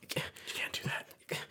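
slow, hushed horrified whisper; hammed-up panic under the breath; mock panicked whisper. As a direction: whisper it as if scandalised, playing panicked.

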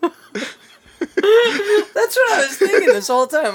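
high laughing words; giggling so hard the voice breaks. Real, not acted. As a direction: squeal through the laugh. Laughing hard while talking.

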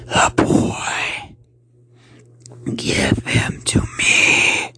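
slow, whispered monster rasp; menacing voiceless rasp, words drawn out; raspy creature. Acted. Creature demanding the boy from the uncle, raspy. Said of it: "it said in a raspy tone".

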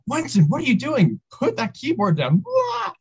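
hammed-up shrill scolding of Wenson; mock freakout. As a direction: shriek it like a teacher losing it. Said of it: "she just goes crazy".

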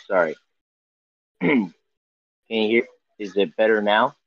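ordinary delivery

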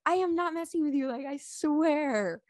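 high-pitched insistence, stretching the last words; imitated flipping out. Husband swearing to wife it's no prank, mock flip-out. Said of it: "Starts flipping out".

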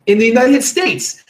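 mock Australian ad voice; hammed-up Australian accent; bad Australian accent. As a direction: put on a clumsy Australian accent like a scam ad. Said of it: "excuse my horrible Australian accent".

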